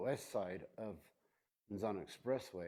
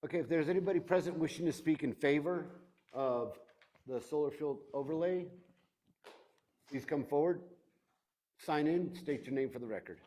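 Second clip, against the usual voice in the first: courtroom recording; 6 semitones higher, 7 dB louder than usual. Real, not acted. Chair calls the public forward.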